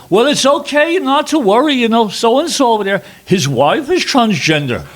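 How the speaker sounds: very high, loud Scottish reassurance; a cheery Scottish voice brushing off worry; Scottish accent. Retold or imitated. The Scottish guard reassuring Petey, in a loud, high Scottish accent. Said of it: "I also loved your Scottish accent".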